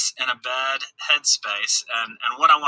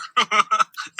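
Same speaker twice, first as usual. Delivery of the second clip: exasperated laugh; 7 semitones higher than usual. Real, not acted. Laughing at dog mess.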